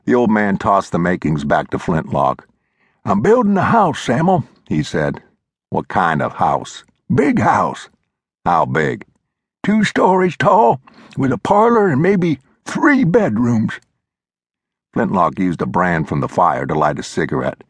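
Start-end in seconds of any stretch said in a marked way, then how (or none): 3.0-13.8 put-on high, reedy old-timer lecturing; old mountain man voice. A narrator's reading. Old Glover scolding Flintlock, high old-timer voice. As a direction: play the cranky old coot setting him straight. Glover tells of his house.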